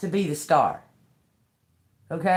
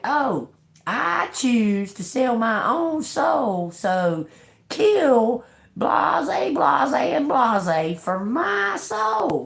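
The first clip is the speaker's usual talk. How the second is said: mocking soul seller; 6 semitones higher, much slower than usual. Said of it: "motherfuckers be, be like".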